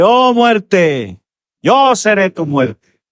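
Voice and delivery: high, slow, ringing proclamation; proclaiming to death. Acted. Preacher voicing Christ, slowly proclaiming triumph over death. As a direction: call it out slowly like a verdict on death.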